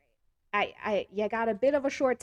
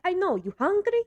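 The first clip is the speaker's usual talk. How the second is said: put-on much higher, louder accented nonna voice; Italian grandma; 11 semitones higher, 7 dB louder than usual. Grandma scolding a guest for not eating, shrill-high mock nonna voice. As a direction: push food on them like a fussing nonna. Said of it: "she'll be like".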